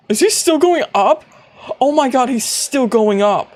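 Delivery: can't believe he keeps rising; astonished. Real, not acted.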